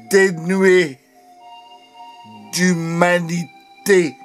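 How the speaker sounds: high, intense poetic outcry; urgent poetic denunciation; impassioned declamation. Acted. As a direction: proclaim each phrase with force, pausing between. Poem climax, refrain declaimed.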